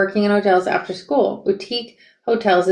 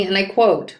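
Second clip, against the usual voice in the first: plain reading voice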